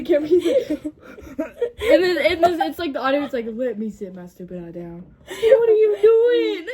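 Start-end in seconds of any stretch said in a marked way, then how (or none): 3.5-5.0 quoted video audio. Retold or imitated. Retelling the audio of a video, lowered mimicking voice. 5.3-6.7 high, loud, drawn-out mimicked cry; mock outcry. Retelling a funny video's audio, loud mock outcry.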